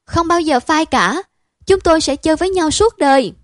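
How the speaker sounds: high, loud vow of friendship; fervent protest. Acted. Đức vows to his friend, high and loud. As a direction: swear it with all your heart, up high.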